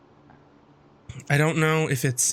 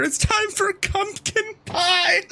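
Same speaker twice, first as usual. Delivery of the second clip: high drawn-out sing-song voice; slower than usual. Acted. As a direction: lilt it up high and slow. Reading spoof chain message aloud.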